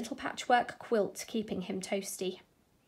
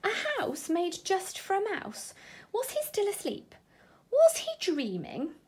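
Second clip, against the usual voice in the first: wondering mouse; 9 semitones higher than usual. Acted.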